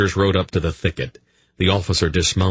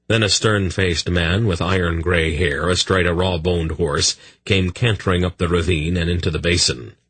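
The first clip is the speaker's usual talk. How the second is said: usual speaking voice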